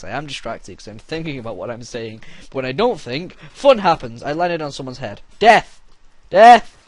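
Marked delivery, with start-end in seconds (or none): 5.4-6.8 shouted very loud and high; battle cry. Pretend. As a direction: scream it charging.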